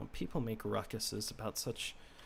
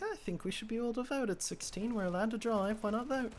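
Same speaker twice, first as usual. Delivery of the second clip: pitched-up, eager plea to grandparents; earnest teen pitch; 10 semitones higher than usual. Retold or imitated. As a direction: play the keen kid pleading his case, lightly. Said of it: "and I'm like".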